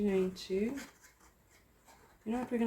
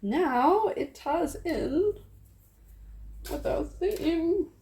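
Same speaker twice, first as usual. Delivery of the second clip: sing-song; 10 semitones higher, much slower than usual. Real, not acted. Sing-song, slow and high.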